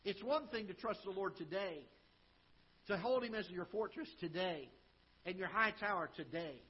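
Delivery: building preaching. Acted. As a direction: build the three todays to a climax. Building repetition of today.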